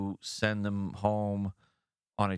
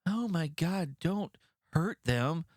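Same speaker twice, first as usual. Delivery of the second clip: higher, pleading mock voice, slow on don't hurt them; mock the panicky officials begging, pitched up; mock whiny plea; 7 semitones higher, slower than usual. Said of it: "get on the phone and go".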